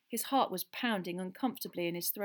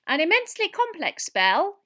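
high, loud piping professor; squeaky lecture opening, emphatic; 12 semitones higher, 13 dB louder than usual. A narrator's reading. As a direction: explain squeakily like a tiny scholar. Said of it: "he said squeakily".

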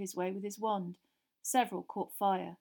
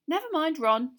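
hasty, higher, louder; quick; 7 semitones higher, 9 dB louder than usual. A narrator's reading. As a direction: rush to calm him. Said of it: "said Hermione quickly".

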